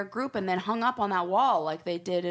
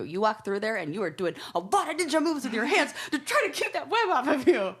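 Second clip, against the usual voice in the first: laughing and rising in pitch; 7 semitones higher, 4 dB louder than usual. Retold or imitated. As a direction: giggle through the words. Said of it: "I'm like".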